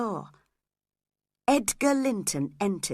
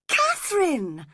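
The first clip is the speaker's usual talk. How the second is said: much higher, louder shocked call of Catherine; shocked cry; 9 semitones higher, 3 dB louder than usual. A narrator's reading. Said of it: "cried Edgar, shocked".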